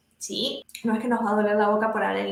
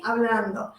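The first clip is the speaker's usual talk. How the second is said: ordinary talk, nothing marked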